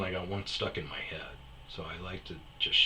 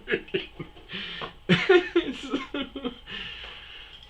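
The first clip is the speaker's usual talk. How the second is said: long real laugh. Real, not acted. Long laughter after the joke.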